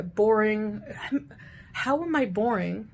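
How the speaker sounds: normal talking voice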